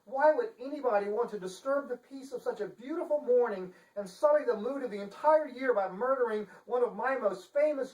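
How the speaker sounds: high, prim lady voice; fussy Chancellor. Acted. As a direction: act the shocked, genteel Chancellor.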